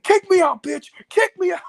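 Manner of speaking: shrill mimicry of a tantrum; imitated yell. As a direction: bellow it like a raging streamer. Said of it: "I remember he yelled at you".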